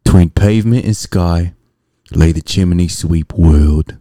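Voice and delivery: slow, measured Cockney-style poem reading; theatrical verse recital. Acted. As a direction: recite it like a chimney sweep. Recites Mary Poppins verse as quote.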